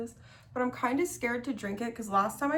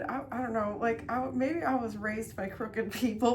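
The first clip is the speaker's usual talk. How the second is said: ordinary talk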